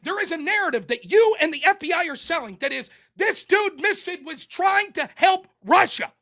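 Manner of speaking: debater ranting at his opponent; heated rant. Real, not acted.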